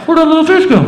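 high, loud snorkel mumble; puzzled, high-pitched snorkel voice; talking through a snorkel. Retold or imitated. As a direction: imitate muffled talk through a snorkel. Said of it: "I'm talking to her through the snorkel".

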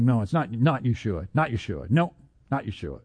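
low, soft brush-off; dismissive denial. Retold or imitated. Officer answering the excited soldiers, dismissive. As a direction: imitate an officer quietly waving it away.

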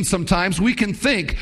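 ordinary talk

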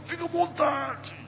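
thin, very high squeezed voice, softly; voz que não sai. Retold or imitated. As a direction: imitate barely getting words out through pain. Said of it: "A voz já não saía". (fiapo de voz aguda, quase sem sair)

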